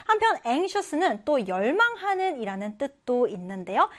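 ordinary talk, nothing marked

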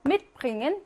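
"Mitbringen" stressed on MIT; first-syllable stress. Shown as a demonstration. Teacher drilling separable verbs, "Mitbringen" stressed on MIT. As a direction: hit MIT at the start of "Mitbringen". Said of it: "일 강세".

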